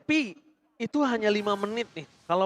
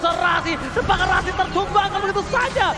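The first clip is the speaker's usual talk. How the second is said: high shouted triple-kill hype call; fighting game announcer; 9 semitones higher, 4 dB louder than usual. Real, not acted. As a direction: scream the play like a fight announcer. Hype esports play-by-play.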